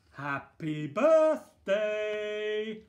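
loud, slow, high drawn-out cheer; surprise shout. Acted. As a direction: yell it like a crowd springing a surprise. Surprise party crowd shouts.

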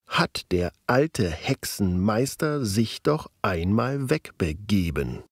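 slow, stressed syllables clearly lifted; metrical stress. Shown as a demonstration. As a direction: tap out the beat of the meter syllable by syllable. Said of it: "würdest du zum Beispiel so betonen". (langsam und übertrieben betont vorgelesen)

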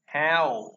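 "how" with a wide ao vowel; correct open "how". Shown as a demonstration. Teacher modelling for learners, "how" with open ao. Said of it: "nó là how".

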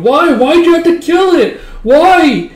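put-on high, loud wailing girl's voice; crying. Girl crying to her father over the dead hawk, high loud wail. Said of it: "going and crying to him".

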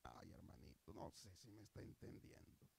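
low, soft drop in voice; quiet sighing appeal after the shouting; hushed aside. Real, not acted. Preacher doubts the brother understands, speaking low and softly.

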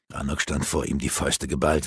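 plain reading voice

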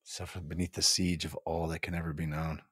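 softly repeated, savoring the words; quiet echo. Acted. Host savors the quote she just read, hushed. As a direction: let the line sink in, hushed. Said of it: "repeats line softly".

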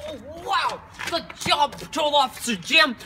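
loud, high cop praise; excited shout. Acted. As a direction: cheer on the rookie.

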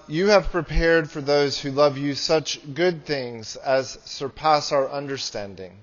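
ordinary talk, nothing marked

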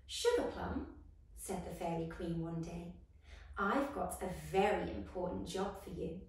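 gentle storybook reading voice; story narration. A narrator's reading. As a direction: read it like a bedtime story. Reading a fairy story aloud.

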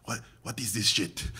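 put-on scoffing like the Hungarian teacher, lightly accented. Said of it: "he was Hungarian".

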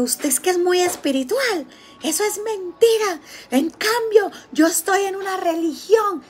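burlándose, voz aguda y chillona; mocking shrill woman. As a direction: imitate the angry woman yelling at her. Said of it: "me empezó a gritar".

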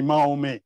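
put-on Mahome said the old French way, ma-o-MAY; French form. Shown as a demonstration. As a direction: give Mahome its French ending, an open -é. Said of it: "the way they pronounce it".

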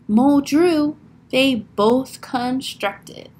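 punchy, deliberate storytelling; dramatic picture-book narration. A narrator's reading. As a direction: act out each sound word for children. Reads picture book aloud.

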